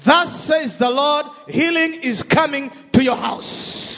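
rising, shouted declarations; preaching building up. Acted.

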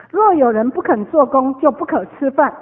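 loud, forceful verse recital; emphatic scripture. Acted. Preaching the verse to the congregation, loud and emphatic. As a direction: proclaim the verse like a rule.

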